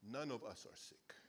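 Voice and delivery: laughing whisper. Acted. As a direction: whisper it with a chuckle. Pastor jokes with congregation.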